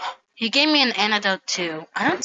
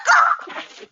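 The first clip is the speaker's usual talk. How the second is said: high-pitched laugh; 8 dB louder than usual. Acted. Character laughs in surprise.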